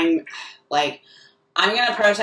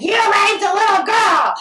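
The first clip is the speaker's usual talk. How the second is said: high, loud drawn-out accusation; retold shout; 13 semitones higher, 5 dB louder than usual. Heckler accusing comic at his show, shouted. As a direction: re-enact yelling it across a room. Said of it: "and then I shouted".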